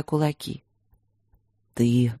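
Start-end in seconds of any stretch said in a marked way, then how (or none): none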